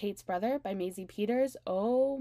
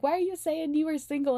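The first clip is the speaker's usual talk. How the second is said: accusing; 7 semitones higher, 6 dB louder than usual. Retold or imitated. Commenters' accusing question.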